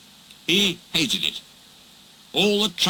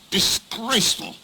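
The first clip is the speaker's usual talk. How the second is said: indignant; 7 semitones higher than usual. Acted. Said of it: "said Gordon".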